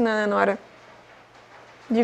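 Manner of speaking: glass held at lips; talking while sniffing wine; speaking into wine glass. Real, not acted. Seen 0: Raises wine glass to nose, rim covering mouth while talking, 6.7–8.5s.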